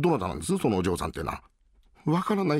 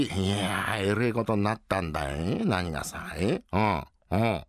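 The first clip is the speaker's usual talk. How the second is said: ordinary talk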